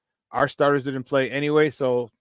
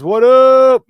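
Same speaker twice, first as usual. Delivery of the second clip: excited greeting; 9 semitones higher, 11 dB louder than usual. Real, not acted.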